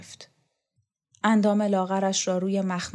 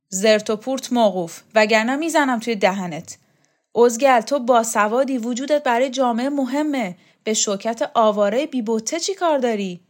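put-on louder, gruff old champion; Shokat's voice; 4 semitones higher, 6 dB louder than usual. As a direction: brush the boy off, irritated. Shokat scolding Borzu.

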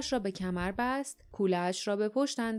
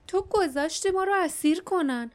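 high-pitched, accusing reproach; reproachful; 6 semitones higher, 3 dB louder than usual. A narrator's reading. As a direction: lay the blame on them, voice raised high.

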